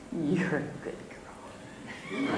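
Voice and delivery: soft, voiceless whisper; whispered tenderly. Retold or imitated. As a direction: whisper it like a kind old lady blessing a child. Kind old lady's blessing.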